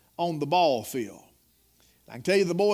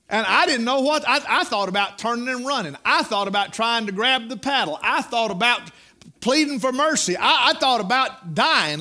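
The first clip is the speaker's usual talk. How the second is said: higher, louder preacher building the story; preaching at full force; 3 semitones higher, 4 dB louder than usual. Acted. Preacher telling his congregation of the paddling, full-force preaching. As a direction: preach it loud, rising with the tale.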